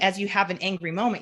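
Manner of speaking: normal talking voice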